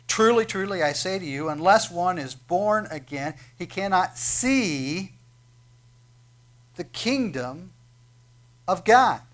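loud, slow, ringing scripture read; preaching at full force. Acted. Preacher proclaiming Jesus's words to Nicodemus, booming. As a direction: proclaim it to the whole room, slowly.

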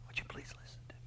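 whispered, limp pleading request; a meek, breathless whisper of a plea; passive whisper. Acted. Preacher mocking a passive God, whispered. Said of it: "not just passively going".

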